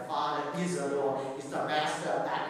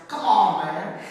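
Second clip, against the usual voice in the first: louder Southern-style exclamation; Southern accent; 8 dB louder, slower than usual. Retold or imitated. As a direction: punch it out like a Southerner. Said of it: "Or what the Southerners".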